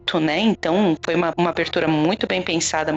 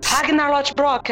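gruff dog call; 6 semitones higher, slower than usual. Retold or imitated. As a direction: call the dog like you're scolding it. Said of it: "parece que tá brigando com alguém".

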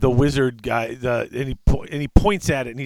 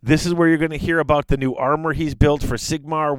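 usual speaking voice

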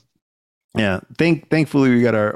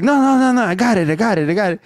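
insistent; 9 semitones higher, faster than usual. Retold or imitated. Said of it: "And the guy's like".

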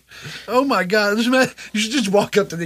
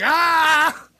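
mock startled yelp; 9 semitones higher than usual. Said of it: "I was like".

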